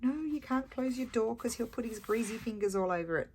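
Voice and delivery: high-pitched, imitated protest; mock beside himself. Husband fretting about greasy fingers on the door, mock panic. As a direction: pitch it up like someone fretting, half in fun. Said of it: "He was really beside himself".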